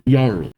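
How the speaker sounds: Jarl said with a Y sound, "yarl". Shown as a demonstration. Reader clarifying the name, Jarl pronounced "yarl". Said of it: "the J is a Y".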